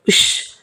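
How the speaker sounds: soft, voiceless "shh"; hushing shush. A narrator's reading. Servant silencing his shouting employer, hushing shush. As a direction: hush someone urgently under the breath. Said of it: "అని రహస్యంగా చెప్పి".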